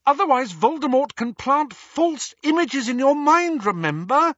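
high, insistent lecturing voice; shrill scolding girl. A narrator's reading. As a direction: scold him, fast and urgent.

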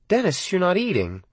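a caring mom asking; mother's voice. A narrator's reading. Narrator voices his mother.

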